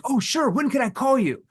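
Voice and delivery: high, eager 'Oh, sure'; eager to please. Retold or imitated. Salesman mocking the needy reply trained reps give, eager. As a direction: sound overeager and needy. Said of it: "what you've been taught to do".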